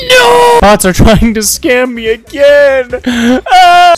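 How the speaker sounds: high, loud wailing; hammed-up despair. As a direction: cry out in mock agony, dragging the words. Wails at scamming bots.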